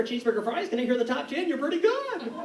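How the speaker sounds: ordinary talk, nothing marked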